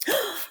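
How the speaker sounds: high strained grunt of pain, soft; dying groan. Acted. Edmund struck down in the duel, groaning. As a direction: take the hit with a groan.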